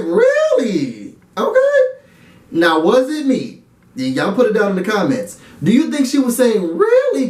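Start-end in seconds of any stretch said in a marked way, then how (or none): 0.0-1.8 "Really?" falling, "Okay" leaping up high; a skeptical "Really?" then "Okay" shooting up; surprised really okay. Retold or imitated. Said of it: "she's like". 6.8-7.3 "Really?" jumping way up high, then falling; a shocked high "Really?". Retold or imitated. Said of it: "she's shocked".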